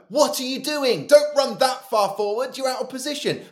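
loud, high touchline yell; exasperated coach shouting across the pitch; manager's shout. Acted. Acted manager shouting at a player.

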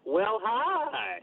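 high, drawn-out Southern cat greeting with a twang. Retold or imitated. Guest greeting his friend the host, in a high Southern cat voice. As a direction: greet her like the meme cat with a Southern drawl. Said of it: "the little Southern cat voice".

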